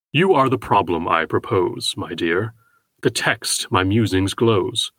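slow, measured poetry reading; poem recitation. Acted. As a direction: read each line slowly, like verse.

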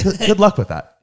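high, mocking good luck; sarcastic. Shown as a demonstration. Testing the phrase, a high mocking good luck. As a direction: lift the pitch and sneer it. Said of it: "you can't say that sincerely".

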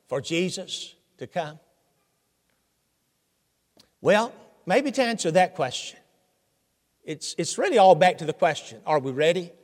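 ordinary talk, nothing marked

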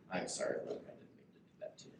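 whispered fast aside to Luke; whispered apology. Real, not acted. Pastor whispers a quick apology to Luke in church, hushed.

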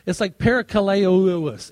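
parakaleouos said slowly and loudly, syllable by syllable; struggling pronunciation. Shown as a demonstration. Pastor tries the Greek word for the congregation, slow and loud. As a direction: sound out parakaleouos with effort. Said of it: "this word I can't even pronounce".

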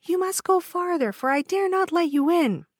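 put-on high, gentle young girl voice; timid maiden refusing. A narrator's reading.

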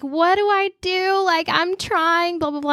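half-acted tearful complaint; retold crying plea. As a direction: imitate a pleading wail to mom, trailing into blah-blah. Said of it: "crying to my mom".

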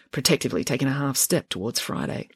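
usual speaking voice

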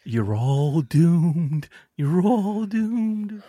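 slow doom-laden prophet voice; slow ominous raspy drawl. Acted. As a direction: drag out the warning, ominous. Ominous Crazy Ralph quote.

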